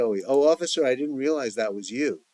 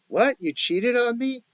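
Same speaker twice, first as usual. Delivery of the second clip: much higher, stunned disbelief; shocked question; 11 semitones higher, 3 dB louder than usual. A narrator's reading. Joey learns his wife is leaving, shocked. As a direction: leap up in pitch in shock. Husband hears wife left for someone.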